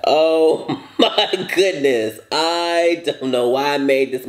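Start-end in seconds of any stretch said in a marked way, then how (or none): 0.1-2.2 laughing. Real, not acted. Laughing at food.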